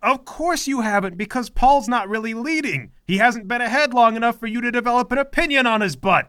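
mother barking orders at the team; bossy mother. Acted. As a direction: order everyone around like an imperious mom. Mother needling [name].

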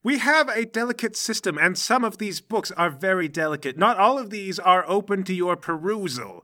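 high-pitched, fussy telling-off; indignant librarian. Acted. Librarian scolds dad over delicate books, indignant high-pitched lecture.

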